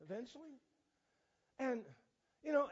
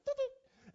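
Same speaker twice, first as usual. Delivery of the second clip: tooting gust; 5 dB louder than usual. Retold or imitated. Imitating gust of wind.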